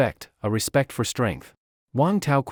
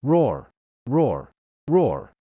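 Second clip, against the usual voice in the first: bear roars; much slower than usual. A narrator's reading. Bear roaring repeatedly.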